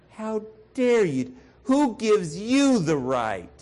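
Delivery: mock indignation. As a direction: play the offended neighbour. Voicing offended listener.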